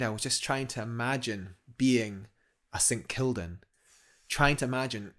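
ordinary delivery

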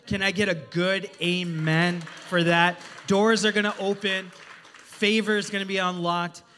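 loud, punchy preacher proclamation; rally the room with faith; preaching call-out. Acted. Preacher calls for amen.

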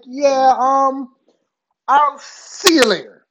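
comically uneasy brush-off; uneasy exit. Retold or imitated. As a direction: edge away with an awkward goodbye. Backing out of the room scared.

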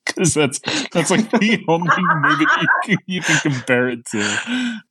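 real giggles through the words; laughing while talking. Real, not acted. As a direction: crack up midway through the sentence. Cracks up at own comparison.

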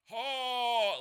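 high, loud choked-off yell; strangled yell. Retold or imitated. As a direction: yell with the voice locking up. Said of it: "his vocal cords lock up".

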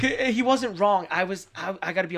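real laughter; laughing admission. Real, not acted. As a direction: giggle out the admission.

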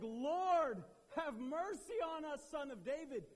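loud, high desperate cry. Retold or imitated. Blind men crying out to Jesus, loud. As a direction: cry out for mercy. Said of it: "They were like".

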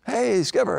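high, light, chirpy hello; innocent pitcher greeting. Retold or imitated. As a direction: greet the boss all innocent. Klein greets manager innocently.

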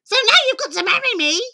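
high, loud screech of glee; triumphant scream. Acted. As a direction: screech it as the gloating ugly sister. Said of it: "But she screams".

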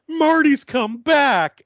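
slow, high, hammed-up cry; a parody of a dim character; mock excited announcement. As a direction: draw it out like a silly revelation. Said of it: "Carol goes".

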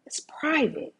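hushed, fully whispered. Shown as a demonstration. Preacher showing the congregation what whispering signals, whispered. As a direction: whisper it like a secret. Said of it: "See, when you whisper".

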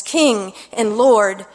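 ordinary talk, nothing marked

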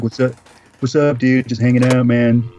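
lightly voiced hangout dude; level, casual buddy greeting; mock dude impression. Showing what he sounds like, lightly voiced dude. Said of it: "that's what it's like".

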